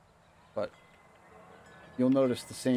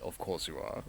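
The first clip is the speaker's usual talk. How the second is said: softer, understated Bond imitation; unfazed Bond quip; 3 semitones lower, 5 dB quieter than usual. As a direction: lightly imitate Bond's unbothered reply, dropped quietly. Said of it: "without even missing a fucking beat".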